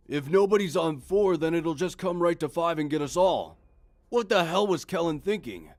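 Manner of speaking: high, loud panicked voice. Acted. Night guard realizing the danger, panicked. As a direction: blurt it out in alarm.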